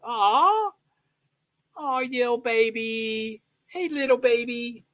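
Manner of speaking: high, slow, drawn-out cooing; sweet high pet-talk. Real, not acted. Baby-talking to a dog.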